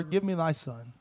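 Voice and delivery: Elijah asks quietly, no spite; soft, calm reply. Acted. Elijah answering the grieving widow, soft and calm. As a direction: say it gently, nothing sharp.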